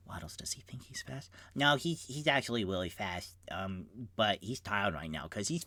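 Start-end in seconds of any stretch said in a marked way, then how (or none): 0.1-1.3 whispered aside. Acted. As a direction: whisper it to the rat. Aside to rat.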